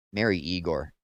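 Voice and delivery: plain reading voice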